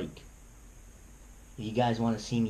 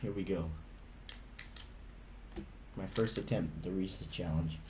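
nervous; 5 semitones lower, 12 dB quieter than usual. Real, not acted. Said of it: "I'm nervous".

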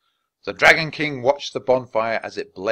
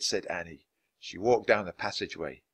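ordinary delivery

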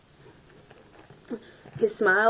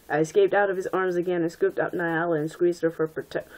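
usual speaking voice

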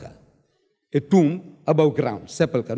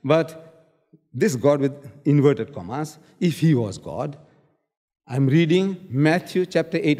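ordinary talk

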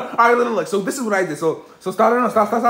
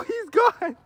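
ordinary talk, nothing marked

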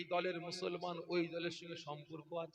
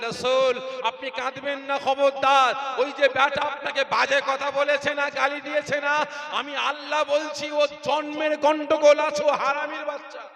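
loud, high, commanding voice of God; Allah's forceful consolation; 9 semitones higher, 14 dB louder than usual. Acted. Allah to the weeping Prophet, loud commanding consolation. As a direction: thunder the comfort down from heaven.

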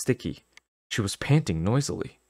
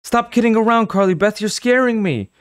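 frightened pleading; 6 dB louder than usual. Acted.

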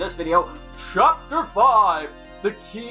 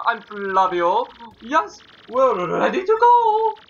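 high, slow, odd pompous voice; weird Flavio voice; 4 semitones higher, 5 dB louder, slower than usual. Acted. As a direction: ham up a strange dandy. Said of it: "this Flavio guy ... something weird like that".

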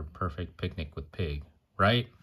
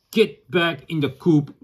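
slow, louder, higher scolding order; stern command; 8 semitones higher, 7 dB louder, slower than usual. Acted. Parent voicing Peg ordering the chickens, a stern slow scold. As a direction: bark it at the chickens. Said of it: "She's like".

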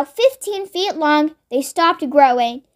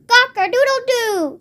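squawking cockerel; 7 semitones higher than usual. A narrator's reading. Cockerel just fed the medicine, squawking crow. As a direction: crow it out like a startled rooster. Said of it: "squawked the cockerel".

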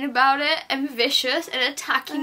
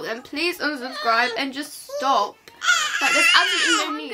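pleading through tears, words rushing out; crying. Real, not acted. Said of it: "sorry I'm crying".

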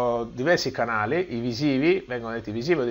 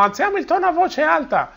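higher pitched voice; raised pitch; 3 dB louder than usual. Shown as a demonstration. Teacher demonstrates high pitch to students, higher. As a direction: lift the pitch right up.

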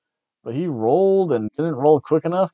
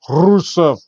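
louder, mock Russian "Rusev"; Russian accent; 3 semitones higher, 6 dB louder than usual. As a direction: snap back into a hammed-up Russian. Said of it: "he tries to start off in Russian".

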